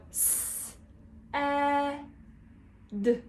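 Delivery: slow, separated sounds in "s, eh, d"; sounding out. Shown as a demonstration. Teacher shows finger-writing practice, slowly sounding out "s, eh, d". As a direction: draw out each of "s, eh, d" with gaps. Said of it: "when you're sounding it out".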